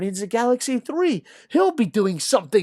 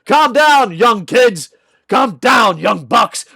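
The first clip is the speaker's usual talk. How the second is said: loud, high calm-down yell; mock scolding shout; 4 semitones higher, 7 dB louder, slower than usual. Host yelling at Young Bucks fans to calm down. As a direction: bark it at the kids.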